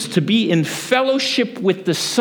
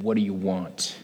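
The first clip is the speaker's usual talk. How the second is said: curt cook; 8 semitones lower, 9 dB quieter than usual. Retold or imitated. Big cook Harry greets a late-night customer, curt and low. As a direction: lower and softer, a blunt diner cook.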